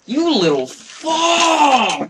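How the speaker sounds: drawn-out very high furious yell; furious shout. Real, not acted. Gamer raging at a mob, long very high shout. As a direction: scream it long and slow. Enemy kills his chance.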